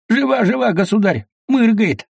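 high, eager, excited report; eager exclaim. Acted. The fawning watchman exclaims high and eager to the tsar. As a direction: blurt it out eager to please.

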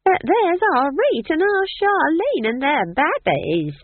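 high, loud, slow delighted cry; swooping happy holler; excited granny. A narrator's reading. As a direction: squeal with pride at spotting family. Nanny calling out happily to family.

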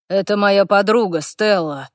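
usual speaking voice

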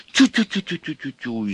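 high, panicky patter; frightened chattering. A narrator's reading. Devil heading off Ivan's row, frightened chatter. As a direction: chatter nervously to shush him. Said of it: "испуганно затараторил чёрт".

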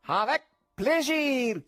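bow with a flourish; gallant conjurer. A narrator's reading. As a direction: suave magician in French.

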